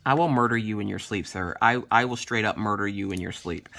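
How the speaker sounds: low, soft deadpan threat; mock menace. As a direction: drop the voice into a quiet, joking threat. Mock threat to her husband.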